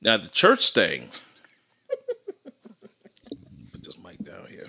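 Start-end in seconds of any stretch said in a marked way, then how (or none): 1.9-2.5 sudden laugh. Real, not acted. Laughs at church topic. 3.7-4.6 soft unvoiced whisper off-mic; whispered aside. Real, not acted. Aside while moving mic.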